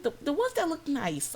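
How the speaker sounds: high-pitched mock customer; fussy Karen. Customer correcting the cashier about bags, fussy. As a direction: imitate a picky shopper, pitched well up. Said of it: "She's like".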